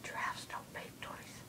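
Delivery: slow breathy whisper. Pretend. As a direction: whisper it slowly like a secret. Giraffe sound joke, whispered.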